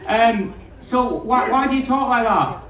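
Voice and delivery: ordinary talk, nothing marked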